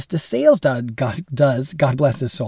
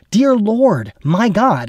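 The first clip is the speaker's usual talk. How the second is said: crying out to God; 7 semitones higher, much slower than usual. Shown as a demonstration. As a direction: call out to the Lord in earnest. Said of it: "I'm actually crying out to God".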